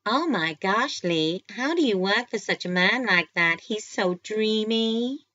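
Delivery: put-on loud, high, gushing American accent. A narrator's reading. Lee mimicking a smitten co-worker to her boss, American accent. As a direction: gush like a swooning American, drawing out the ending. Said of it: "in her best American accent".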